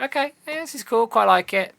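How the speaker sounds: high-pitched, noncommittal mock approval; retold lukewarm approval. Player recalling a first try, lukewarm approval.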